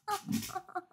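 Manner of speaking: high, faint laugh; soft acted giggle. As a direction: giggle airily in character.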